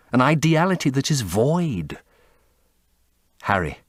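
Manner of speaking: ordinary delivery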